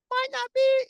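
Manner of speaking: very high, squeaky-high jokey aside; comic falsetto hedge; high-pitched doubt. Pretend. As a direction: jump way up into falsetto. Jokey aside on a coming hurricane.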